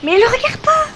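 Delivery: high urgent shout. Real, not acted. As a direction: yell the warning at him. Urgent warning to teammate in game.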